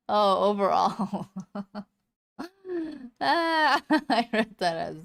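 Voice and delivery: talking through real laughter; laughing. Real, not acted. Reader realizing they misread a comment about hair, laughing. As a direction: laugh while owning up to a misreading.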